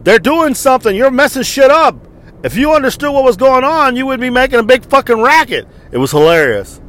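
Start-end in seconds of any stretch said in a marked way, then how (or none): 0.0-5.7 higher, louder retold yell; mock yell. Bystander yelling at the grandmother, mock yell. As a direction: shout her down, imitating the heckler. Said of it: "somebody yelled at her".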